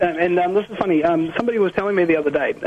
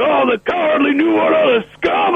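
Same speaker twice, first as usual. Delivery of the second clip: higher, louder ranting outburst; ranting host; 9 semitones higher, 4 dB louder than usual. Retold or imitated. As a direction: rant against the enemy like a radio host. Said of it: "he kinda goes into a rant".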